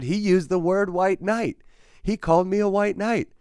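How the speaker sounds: high, loud outraged recounting; indignant disbelief. Real, not acted. Storyteller reports the insult thrown at him, indignant and incredulous. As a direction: voice leaps way up in affront. Outraged at being called a name.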